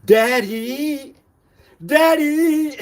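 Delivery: rising calls, last one sung out high; calling daddy. Real, not acted. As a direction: call out, almost singing the name.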